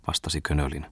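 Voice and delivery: ordinary delivery, nothing marked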